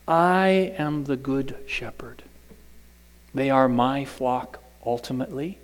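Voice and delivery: hushed, almost whispered; whispered words of Jesus; hushed Jesus quote. Acted. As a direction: speak it softly, like a sacred secret.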